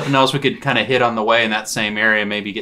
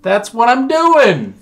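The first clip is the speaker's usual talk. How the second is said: yelling back; 13 semitones higher, 7 dB louder, much slower than usual. Acted. Calling back across the room.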